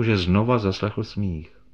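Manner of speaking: usual speaking voice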